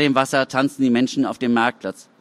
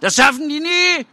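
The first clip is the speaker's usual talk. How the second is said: high, loud jeer from the crowd; heckling yell; 4 dB louder than usual. A narrator's reading. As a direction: yell it out mockingly. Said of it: "brüllt jemand aus der Menge".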